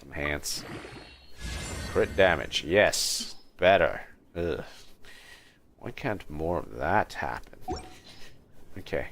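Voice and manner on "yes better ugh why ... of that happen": ordinary talk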